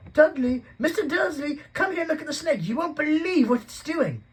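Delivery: put-on very high, very loud excited yell of a boy; deafening shout. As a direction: scream across the reptile house to call them over. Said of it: "a deafening shout behind Harry".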